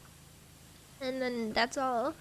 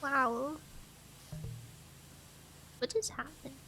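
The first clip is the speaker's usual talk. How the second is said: normal talking voice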